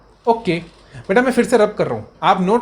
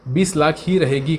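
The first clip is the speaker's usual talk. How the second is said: slow emphasis; 2 semitones lower, slower than usual. Real, not acted. Teacher stresses the key answer.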